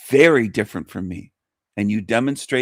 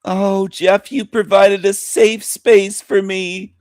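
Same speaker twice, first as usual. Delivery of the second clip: higher, slow, simpering imitation; lightly voiced mocking gushing woman; mock grateful guest; 7 semitones higher, 3 dB louder, slower than usual. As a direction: play the fragile guest sarcastically. Mimics guest thanking host.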